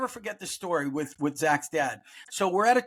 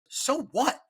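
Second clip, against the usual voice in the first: mock pissed-off retort; 8 semitones higher than usual. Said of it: "and he was pissed".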